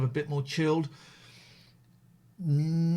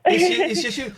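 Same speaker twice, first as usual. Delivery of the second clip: laughing; 12 semitones higher, 8 dB louder than usual. Real, not acted. Host laughs at bipolar joke.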